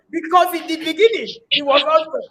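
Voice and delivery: a pastor's weighty, preaching delivery; like my father in the Lord. Shown as a demonstration. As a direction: speak it as a spiritual father would from the pulpit. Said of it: "Let me speak like my father in the Lord".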